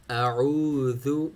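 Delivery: slow अऊज़ु, a stop on अ then an open ain; hamza to ain. Shown as a demonstration. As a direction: glide अऊज़ु from stop to throat. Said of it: "बेहतरीन word हैं".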